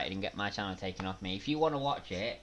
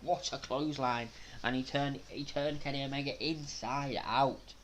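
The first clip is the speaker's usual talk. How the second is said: excited wrestling commentary, pitched up; live play-by-play; 5 semitones higher than usual. Real, not acted. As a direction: call the match as it happens.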